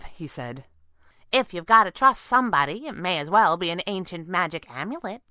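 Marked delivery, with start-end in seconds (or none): 1.3-5.2 higher, louder, offhand concession; shrugging acceptance. A narrator's reading. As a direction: give in with a verbal shrug the flying squirrel agrees to trust the amulet, shrugging. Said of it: "she shrugged".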